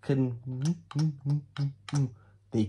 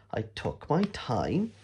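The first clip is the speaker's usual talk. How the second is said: usual speaking voice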